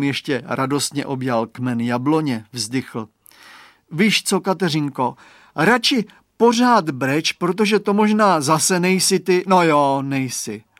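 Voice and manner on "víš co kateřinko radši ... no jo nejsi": put-on boy calling out. A narrator's reading. Chlapec woła w mgle.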